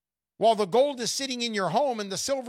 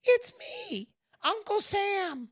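high squeaky voice, slowing on the name; tiny voice; 12 semitones higher, slower than usual. Retold or imitated. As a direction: announce yourself like a tiny gleeful man. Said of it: "he says".

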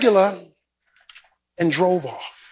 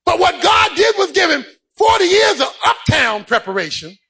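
high, driving sermon delivery; impassioned preacher in full cry; full-force preaching; 11 semitones higher than usual. Real, not acted. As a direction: preach it hard, punching out each phrase.

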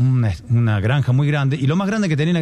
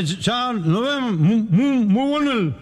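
put-on high, slurred drunk voice; hammed-up drunken babble; too drunk to speak; 8 semitones higher, slower than usual. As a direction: stumble over each phrase like a hopeless drunk. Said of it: "No puede ni hablar".

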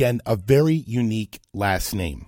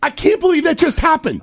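high-pitched, loud disbelief; incredulous shout; 6 dB louder than usual. Real, not acted. Host reacting to the campaign manager's call, incredulous shout. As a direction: shout it, flabbergasted.

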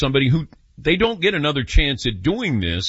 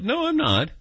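mock realization; 12 semitones higher than usual. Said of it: "you realize".

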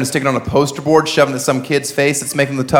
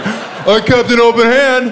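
defensive abuser voice; 8 semitones higher, slower than usual. Pretend. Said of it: "of their".

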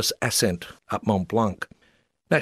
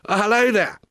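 much higher, plummy English squire voice; British gentleman stepping in, posh squire voice, much higher; Shropshire squire; 12 semitones higher, 3 dB louder than usual. Retold or imitated. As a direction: greet like a fox-hunting English squire. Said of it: "sounding like a squire from Shropshire".

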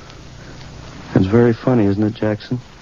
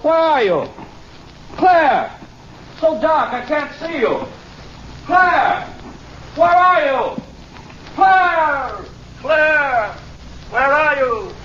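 high, desperate calls into the dark; frantic calling; slower than usual. Acted. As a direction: call her name out across the field, panicking.

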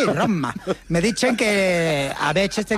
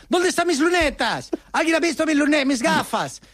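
a lightly voiced fretful old Pope, higher; imitating the Pope, mildly frantic and high; the flustered Pope; 6 semitones higher than usual. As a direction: mimic the Pope hunting for his glasses. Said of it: "cuando ya comienza a ponerse nervioso".

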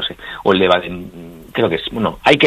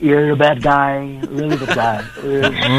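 high, drawn-out English-speaker impression; slow, menacing English "guiri" voice; guiri English accent; 5 semitones higher, slower than usual. Shown as a demonstration. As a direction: put on a foreigner's English accent, pitched up. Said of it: "Hay unos cuantos guiris".

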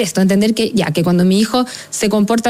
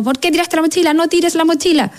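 higher, reproachful parent scolding; a parent scolding over the thrown backpack; 6 semitones higher than usual. Retold or imitated. A parent scolding a child over the thrown backpack, higher and reproachful. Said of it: "si yo le digo como".

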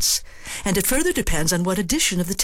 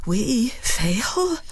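uncertain; 5 semitones higher than usual. Shown as a demonstration. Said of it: "equally uncertain".

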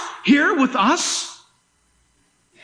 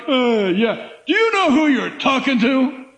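a hint of a haughty rich man, shouting the last line; lightly voiced proud tycoon; smug rich man. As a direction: play the arrogant owner of the world. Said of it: "People are like".

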